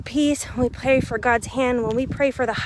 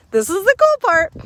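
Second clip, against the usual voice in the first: higher, louder, cool lifted up; pitched-up excited hook for viewers; excited repeat; 7 semitones higher, 3 dB louder than usual. Shown as a demonstration. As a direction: sell the teaser with a bright lift. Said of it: "So this is the cool part".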